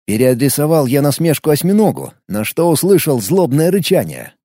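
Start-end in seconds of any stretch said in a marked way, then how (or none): none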